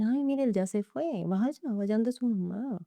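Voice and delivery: lightly voiced cop waving her off; dismissive officer. Retold police sending her away. (policía displicente, lento y agudo)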